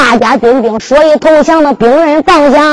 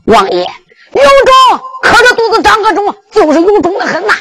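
cheeky brash answer; 7 semitones higher than usual. Acted. Lu Leisheng joking boldly to the old king. 陆雷声都说话了.

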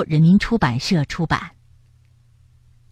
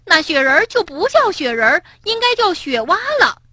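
raised voice; 10 semitones higher than usual. Acted. Rabbit raising his voice at the frog. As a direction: raise your voice in the argument. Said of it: "蹦蹦提高了嗓门儿".

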